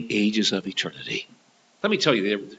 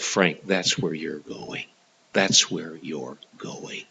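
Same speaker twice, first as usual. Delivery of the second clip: low, soft and very slow; solemn self-warning; 7 semitones lower, 7 dB quieter, much slower than usual. Retold or imitated. Man tells himself where he is going, solemn and low. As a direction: let each word sink in, hushed and grave.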